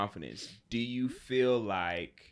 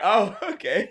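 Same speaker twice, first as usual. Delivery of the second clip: higher, louder laughing oh okay; laughing mock surprise; 10 semitones higher, 9 dB louder than usual. Friend reacting to her boast, laughing and high. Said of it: "I was like".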